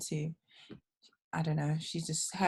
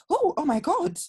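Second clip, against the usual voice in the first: high, laughing oh my God; doctor's shocked gasp; 10 semitones higher, 3 dB louder than usual. Retold or imitated. Doctor caught off guard at the birth, high laughing shock. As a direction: mimic the doctor's startled squeal. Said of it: "the doctor that caught her was like".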